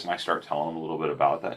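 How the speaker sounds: usual speaking voice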